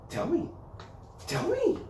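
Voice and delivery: squeaky coaxing; rising squeaky coax. Real, not acted. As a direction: pitch it up to get a bark. Coaxing dog to speak.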